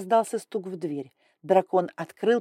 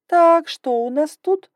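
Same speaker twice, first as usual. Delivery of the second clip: slow, higher, loud inspection; imperious dragon lady; 10 semitones higher, 10 dB louder, slower than usual. Acted. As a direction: size her up slowly, loudly. Grai inspecting, much louder.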